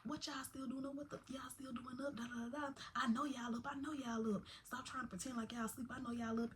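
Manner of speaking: put-on hushed, half-whispered scolding of the kids; the stern auntie who knows they're awake, hissed. Auntie scolding the boys for pretending to sleep, hushed. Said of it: "She was like".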